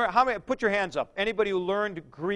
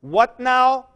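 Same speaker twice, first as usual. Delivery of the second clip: ordinary talk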